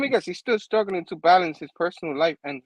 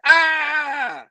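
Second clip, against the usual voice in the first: high mock scream, louder; startled scream; 10 semitones higher, 5 dB louder than usual. Robbie scared by the villain, mock scream. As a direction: yelp in fright. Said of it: "He's like".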